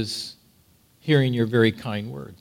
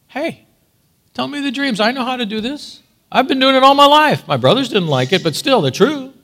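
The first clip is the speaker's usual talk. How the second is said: boastful Joseph; 10 semitones higher, 4 dB louder than usual. Pretend. Imagined boastful Joseph quote.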